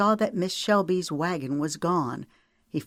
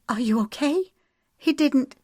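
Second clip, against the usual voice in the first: high, anxious questions; worried; 10 semitones higher than usual. A narrator's reading. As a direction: fear the worst. Shelby worried for sister.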